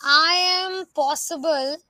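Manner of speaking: I AM drawn out, then "possible" apart; split into separate words. Shown as a demonstration. Teacher showing what an apostrophe changes, "I am" drawn out apart from "possible". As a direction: stretch "I am", then land on "possible". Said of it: "apostrophe हटा दो".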